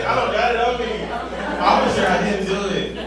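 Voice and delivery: usual speaking voice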